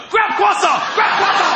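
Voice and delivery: high, loud, frantic commands; mock-military croissant grab; urgent hissed orders. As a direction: bark it like a heist. Urgent breakfast-stealing commands.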